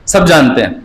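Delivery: normal talking voice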